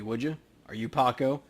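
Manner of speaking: normal talking voice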